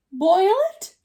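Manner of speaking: shocked elephant, loud and high; gasped shock. A narrator's reading. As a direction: gasp it out in disbelief. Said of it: "gasped Horton".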